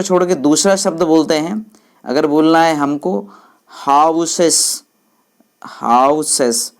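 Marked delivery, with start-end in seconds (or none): 3.7-4.8 houses slowly, with हाउ held long; teacher showing the long stressed हाउ in houses; stressed first syllable. Shown as a demonstration. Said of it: "हाउ बोलने में ज़्यादा time लगता है". 5.6-6.7 houses slowly, long हाउ and brief सेस; slow demonstration of stress on हाउ in houses; stressed first syllable. Shown as a demonstration. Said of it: "हाउ बोलने में ज़्यादा time लगता है".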